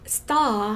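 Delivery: STAR with a long "ah" and no r; slow British-style "stah". Shown as a demonstration. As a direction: drop the r in STAR, hold the vowel. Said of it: "R 자체를 발음 안 해도".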